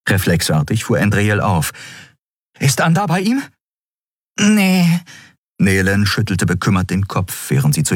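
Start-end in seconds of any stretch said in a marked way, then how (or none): 2.6-3.4 high-pitched urgent question; eager questioning. Acted. Anxious question to lookout. 4.4-5.0 very high, drawn-out no; small creature voice. Acted. Small lookout answers sadly.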